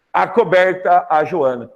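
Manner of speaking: normal talking voice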